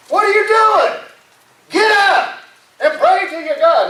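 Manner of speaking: high-pitched, insistent order; emphatic scolding. Acted. Captain waking Jonah to pray, emphatic scolding. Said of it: "he meant it".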